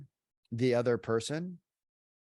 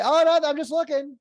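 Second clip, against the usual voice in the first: mock shopper, much higher and louder; squeaky quick brush-off, pitched way up; 10 dB louder than usual. Shopper dodging the clerk: high loud brush-off. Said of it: "the sweet lady comes by".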